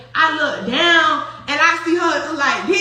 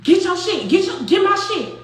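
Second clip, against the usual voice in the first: higher-pitched mock outburst; imitated angry demand; 5 semitones higher, 4 dB louder than usual. Noonie demanding her things from Flo, imitated outburst. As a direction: mimic someone losing it, lightly pushed. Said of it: "start really losing her shit".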